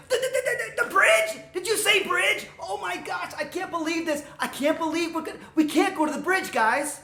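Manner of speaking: high-pitched stammering alarm; frantic goat, stumbling over the first word; panicking. Acted. Little goat hearing about the bridge, panicking. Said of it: "he started panicking again".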